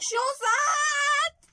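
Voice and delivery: high, slow tearful shout of joy. Real, not acted. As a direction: cry it out with joy. Announcing pregnancy to sister.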